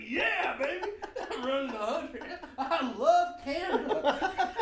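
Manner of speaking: high-pitched, laughing whoop of joy; mock elation. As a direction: crow about it in a hammed-up high voice. Retold elation at driving fast.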